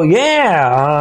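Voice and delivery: ordinary talk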